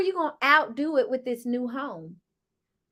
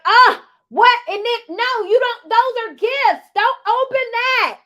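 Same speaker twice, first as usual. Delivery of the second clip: high, loud imitation of a yelling mom; imitated frantic scolding at full voice; 10 semitones higher, 8 dB louder than usual. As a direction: yell in alarm, pitch jumping up. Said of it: "so she's yelling like".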